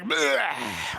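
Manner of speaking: disgusted bleh. Retold or imitated. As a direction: imitate a drinker's revolted groan. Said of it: "he always makes a face like".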